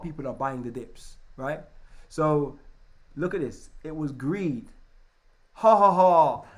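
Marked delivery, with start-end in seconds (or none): 5.5-6.4 loud fake ha ha ha; mocking laugh. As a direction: cackle at the greedy crowd. Mocking greedy crowd.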